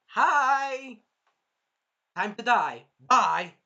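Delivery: loud, punched-out one-liners; gleeful attack call. Retold or imitated. Raphtalia showing up behind the villain, loud taunt. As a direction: call it out loudly, taunting the victim.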